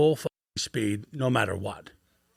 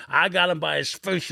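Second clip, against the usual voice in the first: ordinary talk, nothing marked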